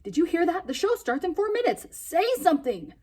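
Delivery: high, loud urgent scolding; a boy accusing the silent parrot as time runs out; frantic demand. Acted. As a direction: demand the bird speak, now. Boy scolding parrot, deadline near.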